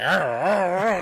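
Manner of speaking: caveman grunts. Retold or imitated. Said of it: "He's like".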